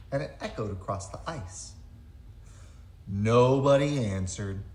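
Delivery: normal talking voice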